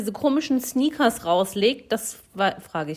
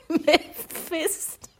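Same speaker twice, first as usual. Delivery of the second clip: laughing; 6 semitones higher, 4 dB quieter, much slower than usual. Real, not acted. Laughing repeat of the answer.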